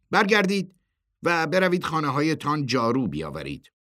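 high, loud command; barked order. A narrator's reading. Chairman sends the group home for brooms, commanding.

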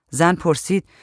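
ordinary delivery, nothing marked

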